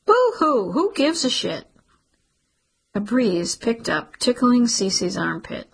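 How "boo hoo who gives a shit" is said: high mocking sob-sound, then dismissive; mock boo-hoo. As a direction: sneer at his sorrow. Cece mocks his grief.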